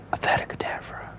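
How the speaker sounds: soft, menacing whisper; evil whispered curse. Acted. Evil player casting the killing curse, whispered. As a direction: whisper the spell like a secret villain. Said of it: "I'm gonna be like".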